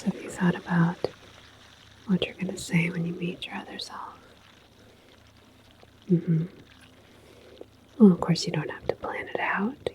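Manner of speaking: usual speaking voice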